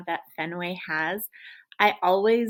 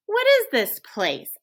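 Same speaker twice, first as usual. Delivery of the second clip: bewildered question; 11 semitones higher, 8 dB louder than usual. Acted. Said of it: "I say".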